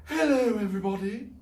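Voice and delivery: high, loud diva greeting; grand entrance. Acted. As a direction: sweep in like a star.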